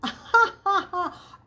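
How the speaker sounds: loud, high amused chuckle. A narrator's reading. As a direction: laugh out at the boy's odd word. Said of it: "Then Mister Hendricks chuckled".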